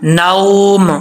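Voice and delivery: नाउम ending on a clear, humming म; clear meem. Shown as a demonstration. Teacher making sure the final म in नाउम is heard. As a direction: make the म at the end of नाउम audible. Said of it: "आवाज़ आनी चाहिए मीम की".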